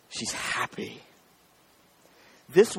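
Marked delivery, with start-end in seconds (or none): 0.1-1.0 whispered, almost breathless; hushed. Real, not acted. Pastor moved by the girl's joy, whispering. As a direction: let it out as a soft whisper. Awed close to the story.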